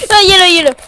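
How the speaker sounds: loud, high alarmed cry; startled shout. Real, not acted. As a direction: blurt it out in fright. Monster appears again.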